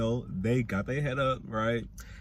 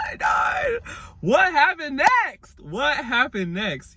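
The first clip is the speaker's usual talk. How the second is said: laughing shout; 14 semitones higher, 7 dB louder, slower than usual. Real, not acted. Laughing shout after phone dies.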